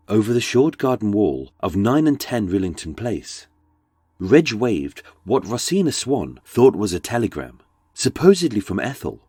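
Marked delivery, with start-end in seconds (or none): none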